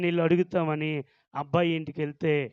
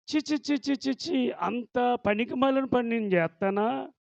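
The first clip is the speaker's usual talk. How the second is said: scoffing tut-tut; 5 semitones higher than usual. Retold or imitated. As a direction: wave it off with high tutting. Boy scoffs in story.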